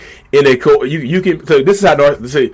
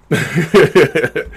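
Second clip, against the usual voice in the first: short amused chuckle; a quick real laugh. Real, not acted. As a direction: laugh at your own line. Laughs at own joke.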